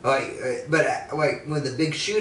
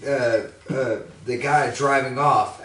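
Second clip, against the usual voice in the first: ordinary delivery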